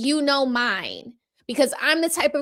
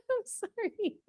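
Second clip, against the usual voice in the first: much softer, laughed-through sorry; quiet laughing I'm sorry; laughing apology; 13 dB quieter than usual. Real, not acted. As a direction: giggle out an apology.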